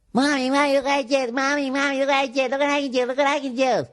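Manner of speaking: put-on high, whining child voice; whiny kid. Kid at the pool calling to mommy, high whine. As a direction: nag for attention like a little kid. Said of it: "listen to them with their kids".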